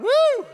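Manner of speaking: loud, high "woo"; high cheering whoop. Real, not acted. Host egging on the audience, high loud whoop. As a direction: whoop along with the crowd.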